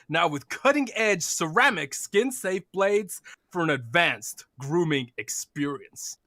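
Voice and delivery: mock American ad read, light American accent; hammed-up American accent sponsor read. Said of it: "in your, uh, American accent".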